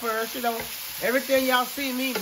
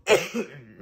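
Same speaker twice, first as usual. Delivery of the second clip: a sudden, congested cough; real cough; 5 semitones lower, 6 dB louder than usual. Real, not acted. Sick cook coughing on camera, real cough. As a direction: cough from a lingering cold. Has had a cough two weeks.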